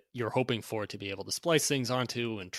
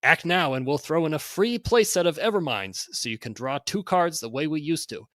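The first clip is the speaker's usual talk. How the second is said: loud, high hyped sales pitch; carnival barker; 3 semitones higher, 3 dB louder than usual. Acted. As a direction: hawk it like a sideshow barker. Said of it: "read this in sort of a carnival barker type voice".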